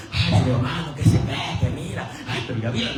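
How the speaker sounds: low, sneering gossip voice; mock a nosy church critic; a church member gossiping about a brother, mocking.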